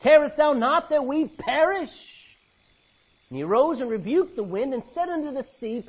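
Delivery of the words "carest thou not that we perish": loud, high desperate plea; frightened cry. Acted. Disciples crying to Jesus in the storm, loud and desperate. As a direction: cry out as if the boat is sinking.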